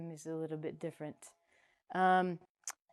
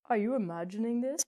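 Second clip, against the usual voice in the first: ordinary talk, nothing marked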